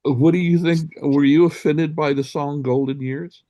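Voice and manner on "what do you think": ordinary delivery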